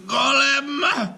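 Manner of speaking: high, loud ringing command; commanding call. A narrator's reading. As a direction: summon the golem to life with force. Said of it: "commanded the rabbi".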